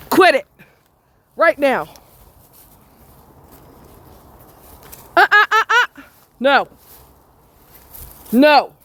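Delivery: loud high quit it; scolding dog. Real, not acted. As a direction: snap the dog back in line.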